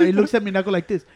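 ordinary talk

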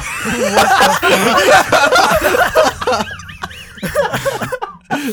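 loud shrieking laugh; high burst of laughter. Real, not acted. Laughing at Cameron's bit.

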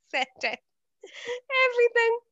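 words breaking up with laughter; laughing. Real, not acted. Caller telling co-host how she got the agent round, laughing. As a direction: laugh through it, voice climbing high. Laughing while retelling her win.

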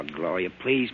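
ordinary talk, nothing marked

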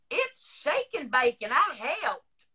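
imitating a little girl's Georgia drawl; little girl from commercial. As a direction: recite it like a proud small Southern child. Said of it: "This little girl in this commercial would say".

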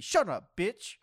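loud, high mock outburst; sudden shouted comeback, playfully over the top; mock angry yell. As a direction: yell it back in mock outrage. Snapping back at mocked friend.

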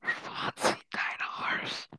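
slow, secret whisper. Real, not acted. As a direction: lean in and whisper it slowly. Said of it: "das darf niemand hören".

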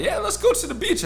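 high, breezy imitation of mom. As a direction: lightly play the carefree mom. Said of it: "My mom talking about".

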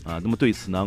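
ordinary talk, nothing marked

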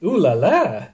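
cheesy Gallic ooh la la; mock French ooh la la. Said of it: "they just go".

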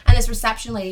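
ordinary delivery

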